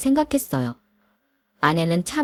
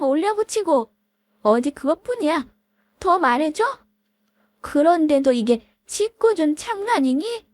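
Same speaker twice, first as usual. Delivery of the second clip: higher voiced feuding old classmates; catty women arguing; 7 semitones higher than usual. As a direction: snipe and bicker like offended women. Women quarrel at reunion. (동창생 여자들의 날 선 말다툼)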